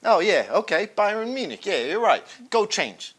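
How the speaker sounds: ordinary talk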